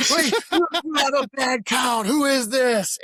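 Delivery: high, loud imitated scolding; mock angry shout. Instructor finding the head count is off, imitated shouting. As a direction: yell it like an outraged instructor.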